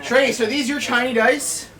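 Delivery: delighted. Real, not acted. Admiring someone's tiny dice.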